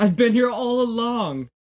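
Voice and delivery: high, belted diva reveal. Acted.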